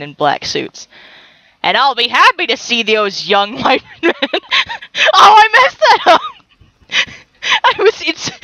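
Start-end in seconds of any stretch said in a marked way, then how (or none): 5.1-8.3 high, loud cry through helpless laughter; cracking up at your own blunder; squealing with real laughter; laughing hard. Real, not acted. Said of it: "I'm laughing so hard".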